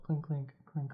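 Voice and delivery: small high clink noises; light, soft clinks of jostling bottles; imitated bottle clinking. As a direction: mimic bottles rattling on a cart.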